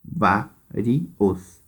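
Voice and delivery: "vários" split into vá, ri, os with pauses; syllable by syllable. Shown as a demonstration. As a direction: count out "vários" in three separate syllables. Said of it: "três sílabas".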